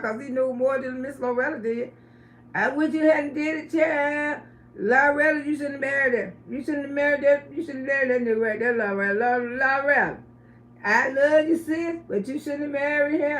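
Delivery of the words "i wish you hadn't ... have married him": fondly scold, lightly putting on his voice; effeminate homeboy. Retold or imitated. Homeboy telling Loretta she married wrong, imitated effeminate voice. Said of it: "this is the way the guy used to talk".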